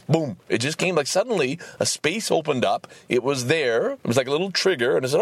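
ordinary delivery

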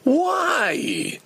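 high, anguished wail; disturbed. Real, not acted. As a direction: drag it out in a high, upset cry. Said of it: "I'm just, just too disturbed by it".

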